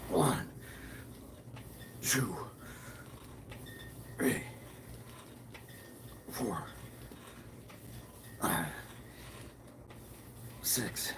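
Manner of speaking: counting softly under breath, fading to a whisper; whispered rep count while exercising; quiet counting. Real, not acted. Counting push-up reps.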